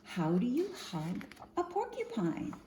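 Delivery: usual speaking voice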